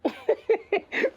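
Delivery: high staccato giggle. Acted. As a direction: giggle in a high rapid burst. Odd customer giggles at a question.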